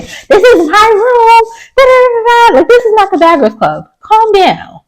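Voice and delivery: camp it up like reality drama; mock Bad Girls Club. Mocking Phil to viewers in a high diva voice.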